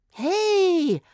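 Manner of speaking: high, loud hey; call out. A narrator's reading. Girl calling out to her friends. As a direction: call attention sharply. Zora calls out.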